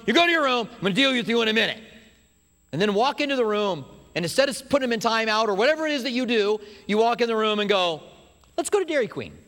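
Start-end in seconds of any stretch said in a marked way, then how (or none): 0.1-1.8 higher, louder parental order; stern parent. Acted. Parent ordering a misbehaving kid to his room, stern. 8.6-9.3 high, bright invitation; spring the treat on them happily; cheery surprise. Acted. Parent surprising a guilty kid with ice cream, cheerful.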